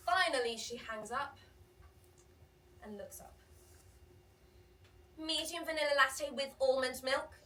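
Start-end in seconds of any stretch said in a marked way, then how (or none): 5.2-7.3 curt order. Retold or imitated. Customer orders curtly.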